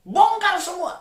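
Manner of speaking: high, loud command; commanding preaching. Acted. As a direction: order them to let it go. Preacher answers prayer. (perintah khotbah yang keras dan tinggi)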